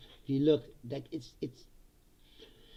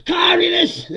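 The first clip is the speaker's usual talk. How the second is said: a sudden high, loud cry of outrage; hammed-up shout of outrage; mock outraged shout; 13 semitones higher, 14 dB louder than usual. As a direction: yell it like a scandalised pundit. Mocking the reaction to grocery stores.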